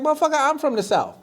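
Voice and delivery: high, fast protest; mock indignant retort. Panelist defending his Southern roots, mock indignant. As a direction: rattle it off high in mock outrage.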